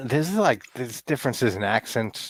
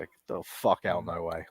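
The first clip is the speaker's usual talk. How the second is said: put-on New York accent; 3 semitones lower, 6 dB quieter than usual. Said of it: "exactly how I would say that".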